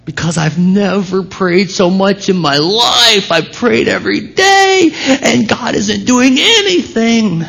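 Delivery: retelling a new believer's frustrated cry, high and pained; frustrated crying outburst. Retold or imitated. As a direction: voice shooting high into a near-shout, close to tears.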